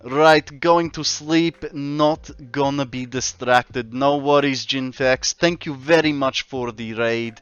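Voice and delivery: a light mock Russian accent. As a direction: ham up a Russian man's accent. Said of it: "he wants me to speak like Russian man".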